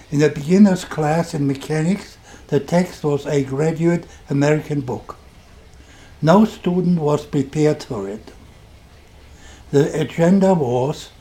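ordinary talk, nothing marked

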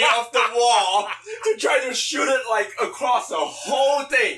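excited climax. Real, not acted.

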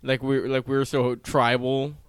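usual speaking voice

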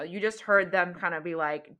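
ordinary talk, nothing marked

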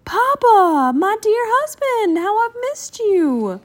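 very high, swooning cry; gushing, high, melodramatic wife; overjoyed reunion. A narrator's reading. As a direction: exclaim to a long-missed husband, drawn out at the end. Mama reunited with her husband.

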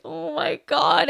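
slow, drawn-out mock wail; overwhelmed, imitated crying moan; retold tearful dismay. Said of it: "could not do anything else besides cry".

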